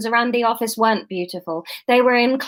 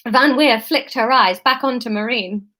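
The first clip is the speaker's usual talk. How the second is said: usual speaking voice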